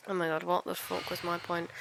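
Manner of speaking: normal talking voice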